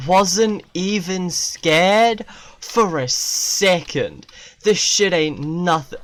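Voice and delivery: an exaggerated fake-brave brag; mock bravado. Shown as a demonstration. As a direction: drag it out, cocky. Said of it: "Easy dubs".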